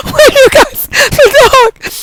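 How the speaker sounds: high, loud words through laughter; trying to talk while cracking up; helpless laughter. Real, not acted. Reader cracking up about the dog, squealing helpless laughter.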